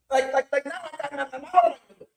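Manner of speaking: stammering, rising pitch; retold outburst of helpless dismay; panicked stammer. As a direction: sputter in mock dismay. Retold man panicking over scandal.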